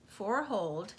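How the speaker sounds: plain reading voice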